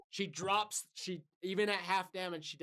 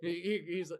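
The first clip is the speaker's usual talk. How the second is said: ordinary delivery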